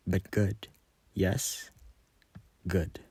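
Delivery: soft, menacing murmur; sinister hush. Acted. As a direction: purr it quietly.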